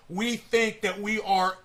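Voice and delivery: normal talking voice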